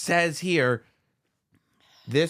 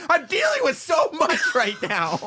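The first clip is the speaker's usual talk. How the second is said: much higher, louder, overwhelmed cry; playing the stressed husband losing it; exasperated shout; 5 dB louder than usual. Cohost voicing the husband overwhelmed by news, shouting. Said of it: "He's got".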